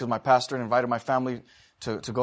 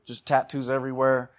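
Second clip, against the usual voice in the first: ordinary talk, nothing marked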